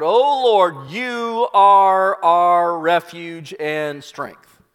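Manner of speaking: loud, drawn-out cry to God; calling out. Acted. Servant calls to the Lord of hosts, calling out. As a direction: proclaim it slowly at full voice. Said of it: "And he calls out".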